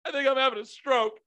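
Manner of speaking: high mock panic; high-pitched mock panic in the cinema; panicked mock. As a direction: squeak it like you're truly scared.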